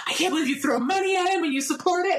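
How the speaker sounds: high, mocking take on the fiance, laughing. Wife mimics her fiance scolding her about [name], laughing. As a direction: laugh through a mock of his outrage. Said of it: "he's like".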